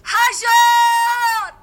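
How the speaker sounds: high, loud, drawn-out cry for her husband; desperate shout. A narrator's reading. Wife screaming for her husband, loud desperate cry. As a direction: scream his name across the plain in terror. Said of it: "باز شوهرش را فریاد زد".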